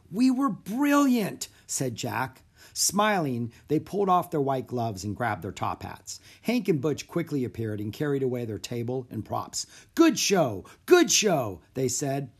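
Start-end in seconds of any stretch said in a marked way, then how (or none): 0.1-1.5 high, drawn-out delight; elated. A narrator's reading. Boy magician celebrates with his partner, elated. Magician exulting after the show. 10.0-11.6 louder, higher cheer; hearty congratulations. A narrator's reading. Stagehands congratulate the magicians, hearty.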